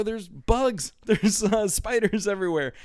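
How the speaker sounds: high, loud panicked kid, acted out; screaming child. Child telling dad about the spiders, mock screaming. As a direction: wail it like a frightened child, half laughing. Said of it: "I'm screaming".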